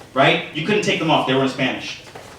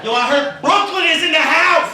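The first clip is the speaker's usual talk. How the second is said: shouted crowd greeting; 12 semitones higher than usual. Real, not acted. Comic hollering at the Brooklyn crowd, laughing hype shout. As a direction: shout out to the room like a party host.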